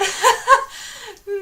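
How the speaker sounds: high, loud laugh at herself; embarrassed laugh. Real, not acted. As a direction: laugh off the memory. Laughs after retold panic.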